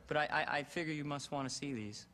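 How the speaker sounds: ordinary talk, nothing marked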